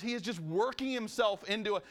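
ordinary talk

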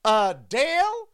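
high, drawn-out mama call; Southern mama calling her child. Acted. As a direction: call the name out slowly, rising high. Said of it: "like you're her mama calling her".

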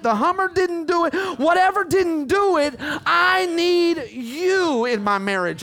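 high, drawn-out, impassioned sermon cry; full-force preaching. Real, not acted. Preacher voicing people crying to God, full-force preaching. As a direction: build a driving list into long, high pleas.

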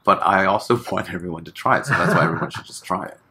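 fast, laughing urge to try it. Real, not acted. Urging everyone to try meditation, laughing. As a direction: laugh through the plea.

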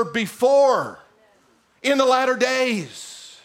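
high, driving preacher delivery; energetyczne kazanie. Acted. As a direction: preach it with insistent, emphatic momentum. Preaches at full force.

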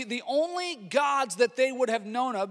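normal talking voice

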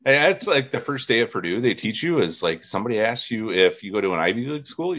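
normal talking voice

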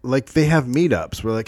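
ordinary delivery

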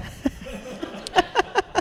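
breathless silent laugh; airy laugh. Real, not acted. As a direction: let out an airy wheeze of laughter. Laughs at own story.

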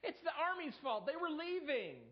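a hammed-up excuse-maker; whiny excuse. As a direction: pass the blame like a sulky child. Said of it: "The blame game".

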